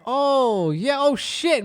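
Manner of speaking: high-pitched mock astonishment; feigned amazement. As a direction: imitate friends politely faking awe. Friends feigning amazement at wrong card.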